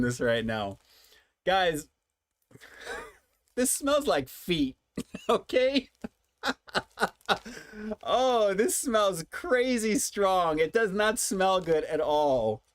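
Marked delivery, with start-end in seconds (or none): 3.6-5.9 laughing in disgust at the smell; slow, high, laughing disgust; smelling something awful. Real, not acted. Reacting to a strong smell. 8.0-12.6 smelling something awful. Real, not acted. Reacting to a strong smell.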